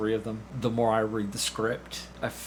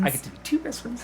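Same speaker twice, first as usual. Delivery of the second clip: delighted echo; 13 semitones higher, faster than usual. Acted. Echoing host's line with delight.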